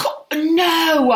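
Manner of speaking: loud, high, spluttered "no"; real scandalised protest; splutter, then cry out; indignant denial. Real, not acted. Denies shoplifting.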